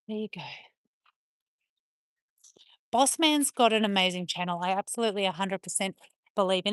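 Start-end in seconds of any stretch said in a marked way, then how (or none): 0.1-0.4 breathy, voiceless murmur to the dog; whispered. Real, not acted. Owner whispering to Angel on the stairs. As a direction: keep it hushed for the dog.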